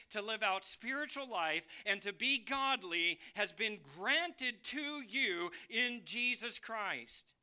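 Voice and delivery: high, driving sermon delivery; forceful preaching. Acted. Preacher telling believers all is granted in Christ, forceful preaching. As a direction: preach it with full conviction, hammering key words.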